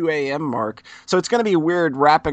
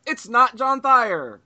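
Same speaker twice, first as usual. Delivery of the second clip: announcer build-up; 8 semitones higher, 4 dB louder, slower than usual. Pretend. Introducing a special guest.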